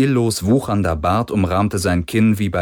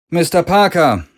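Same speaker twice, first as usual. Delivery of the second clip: higher, louder call without warmth; cool call; 9 semitones higher, 5 dB louder than usual. A narrator's reading. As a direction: call his name out coldly. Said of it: "rief Galen ohne große Herzlichkeit".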